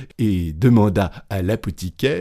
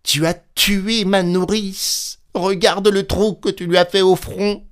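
outraged accusation; 11 semitones higher, 3 dB louder than usual. A narrator's reading. Petit Claus accusing the innkeeper of killing his nurse, outraged. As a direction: accuse in a high, wounded outcry.

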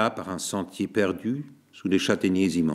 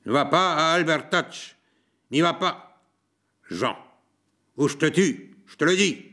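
higher, threatening call; threatening cry; 5 semitones higher than usual. A narrator's reading. Mathieu threatening Jean across the path, crying out. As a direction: warn him off, shouting the threat. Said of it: "cria-t-il".

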